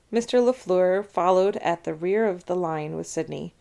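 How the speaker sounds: usual speaking voice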